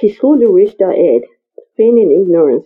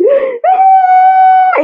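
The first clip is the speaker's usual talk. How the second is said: short high scream; 3 dB louder than usual. Acted. Woman screaming in fear at the man's words, short high scream. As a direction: let out a sudden terrified scream. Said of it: "She screamed".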